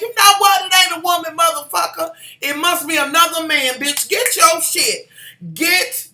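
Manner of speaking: high, loud outraged tirade; angry confrontation. Acted. Imagined showdown with a cheating husband, angry confrontation.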